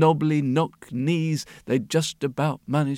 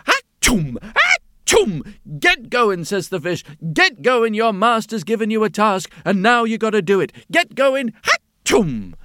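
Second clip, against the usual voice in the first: higher, louder little scold; bossy fish; 5 semitones higher, 5 dB louder than usual. A narrator's reading. Little fish with a stick scolding the donkey, higher and louder. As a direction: hound the donkey like a tiny boss.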